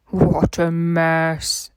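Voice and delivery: slow, drawn-out stern boss voice; disapproving fat controller. Acted. Fat controller scolding Thomas over the statue, slow stern boss voice. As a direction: let the boss sigh it out slowly.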